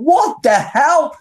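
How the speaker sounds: high, drawn-out disbelief; stunned double take, slow and high; dumbstruck. Retold or imitated. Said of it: "I was just dumbstruck".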